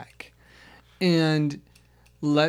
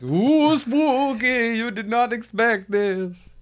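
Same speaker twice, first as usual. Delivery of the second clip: high, slow, drawn-out spooky voice; 7 semitones higher, 4 dB louder, slower than usual. Pretend. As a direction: ham it up like a haunted house ghost. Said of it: "And it's like".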